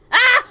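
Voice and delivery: loud, high "ah"; startled scream. Acted. Startled character in the game, loud high scream. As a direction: let out a sudden high scream.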